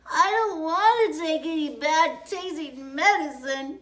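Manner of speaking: put-on high, loud tearful child's whine; sobbing child. Girl refusing medicine, sobbing high and loud. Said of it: "she sobbed".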